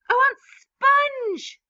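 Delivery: high, slow, tearful plea; high tearful boy's cry. Acted. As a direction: wail it, near tears. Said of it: "James cried out".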